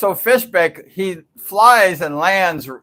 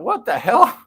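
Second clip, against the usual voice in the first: high, swooping retold disbelief; incredulous; 7 semitones higher than usual. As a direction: pitch it up in baffled astonishment. Retold bewilderment at rider's apology.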